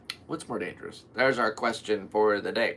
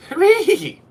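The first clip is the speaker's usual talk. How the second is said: very high, very loud cheer; hooray shrieked at the top of the voice; high shrill hooray; 14 dB louder than usual. Shown as a demonstration. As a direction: squeal it like a cartoon crowd. Cartoon cheer attempts.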